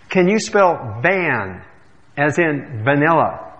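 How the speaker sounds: slowly, with pointed patience; slow pointed question. Acted. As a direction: drag it out like to a child. Clerk spelling slowly, annoyed.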